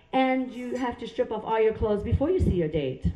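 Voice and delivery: normal talking voice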